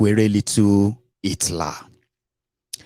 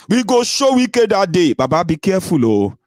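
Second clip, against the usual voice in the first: mock threatening crowd; 10 semitones higher, 4 dB louder than usual. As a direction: call out a boastful threat for others. Voices social media users' threats.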